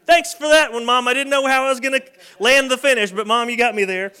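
put-on loud, high, cheeky kid voice thanking mom; hammed-up proud toddler. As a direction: play the caught kid enjoying the joke. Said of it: "Like, just a".

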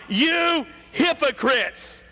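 high-pitched, slow accusing shout; shouted rebuke. Acted. Jesus rebuking the hypocrites in the synagogue, shouting. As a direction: call them out, stretching both words. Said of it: "he just simply shouts out".

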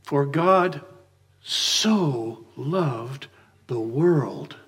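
slow, with "so" stretched and weighted; stress on so. Shown as a demonstration. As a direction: linger on "so loved" with reverence. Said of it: "the emphasis here is on that little two-letter word".

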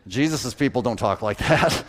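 normal talking voice

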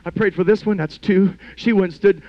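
ordinary talk

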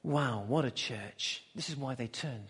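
low, hushed wonder; soft, low-pitched awe; quiet amazement. Real, not acted. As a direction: marvel softly, almost to yourself. Preacher marvels quietly after climax.

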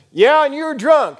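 high, loud comeback; quick retort. Retold or imitated. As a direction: fire back at a heckler. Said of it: "Walter was quick. He said".

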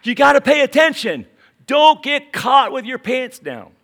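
loud, high warning yell; Jesus bellowing a wake-up call; urgent shout. Acted. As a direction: shout it like sounding an alarm. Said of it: "and he goes".

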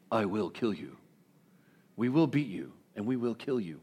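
low, soft, menacing Liam Neeson threat; Liam Neeson impression. Preacher voicing the council's threat as Liam Neeson, low menace. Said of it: "in my best Liam Neeson impersonation".

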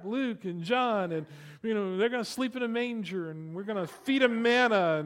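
a newcomer bubbling over about the Bible; gushing enthusiasm with a laugh; excited new believer. Retold or imitated.

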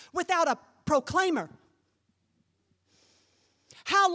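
high, loud preacher's proclamation; full-force preaching. Acted. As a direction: proclaim it to the whole congregation.

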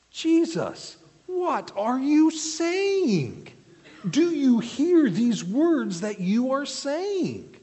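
hammed-up shock at Jesus's words; incredulous outcry. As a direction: cry it out as if you can't believe your ears. Preacher voicing shocked crowd.

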